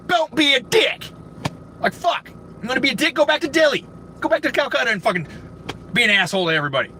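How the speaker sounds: high-pitched angry scolding; angry rant. Real, not acted. Customer tells off rude cashier, angry rant. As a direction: lay into him, pitch riding high.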